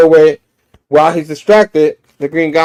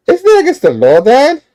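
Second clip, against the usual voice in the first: put-on high, loud kid voice rising at the end; questioning child; 11 semitones higher, 5 dB louder than usual. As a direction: pipe up like a child challenging a parent. Child questions father in story.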